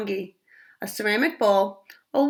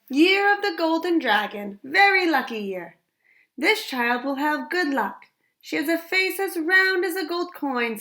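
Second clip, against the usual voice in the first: a father's voice; 7 semitones higher than usual. Acted. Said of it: "said her father".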